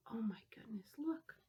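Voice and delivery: hushed amazed whisper. Real, not acted. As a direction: breathe it in awe.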